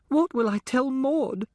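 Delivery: put-on high, slow, tearful girl's voice; a sheltered girl breaking into tears; sobbing. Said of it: "she sobs".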